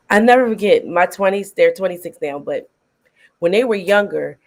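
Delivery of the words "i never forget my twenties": ordinary talk, nothing marked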